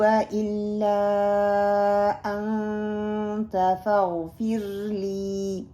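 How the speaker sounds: slow, drawn-out melodic dua recitation; slow Quranic recitation. Shown as a demonstration. Teacher reciting a dua for students to repeat. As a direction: recite each word long and measured. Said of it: "آپ اپنی نمازوں کا اس کو حصہ بنائیں".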